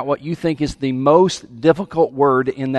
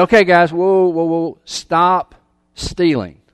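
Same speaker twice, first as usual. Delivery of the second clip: 1 Paul calling a halt; 3 semitones higher, slower than usual. Acted. Preacher voicing Paul halting thieves.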